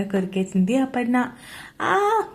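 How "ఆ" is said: high sudden cry when bitten; sharp yelp of pain. A narrator's reading. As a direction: yelp out as teeth sink in. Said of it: "గట్టిగా అరుస్తూ ఉన్నాడు". (కొరికినప్పుడు నొప్పితో ఆ! అని అరుపు)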